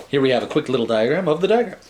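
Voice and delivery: ordinary delivery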